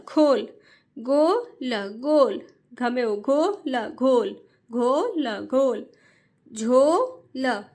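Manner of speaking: each word drawn out slowly, then repeated; slow reading drill. Shown as a demonstration. Teacher reads to parents the way children should, slowly. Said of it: "जिस तरीके से मैं पढ़ रही हूँ".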